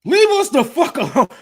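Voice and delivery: a hammed-up fatherly shout; dad's mock shout. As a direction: bark it like the angry father. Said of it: "his dad is like".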